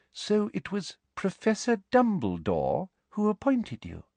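high, slow, sugary official questioning; prim inquisitor voice. A narrator's reading. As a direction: ask slowly with a high prim voice.

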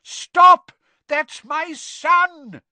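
very loud, high, drawn-out yell; frantic father's shout; desperate yell. A narrator's reading. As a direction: yell it to save your child. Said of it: "yelled a voice he recognized".

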